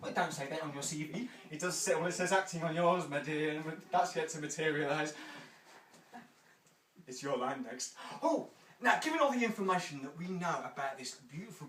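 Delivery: ordinary delivery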